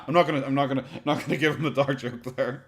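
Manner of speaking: usual speaking voice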